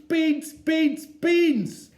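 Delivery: put-on high, loud, wailing toddler chant; toddler screaming. Little Eddie screaming for beans in the supermarket. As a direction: scream it like a furious little boy.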